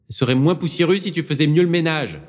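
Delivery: loud, high yell across the church; shouted. Acted. Cardinal shouting at Avril from the back, loud and high. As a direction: bellow it from the back of the nave. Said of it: "hurla le cardinal".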